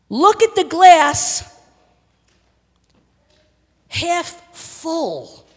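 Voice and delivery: loud, high, slow exhortation; full-force preaching. Real, not acted. Preacher urging congregation to see God's work, full force. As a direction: press each phrase on them with big pauses.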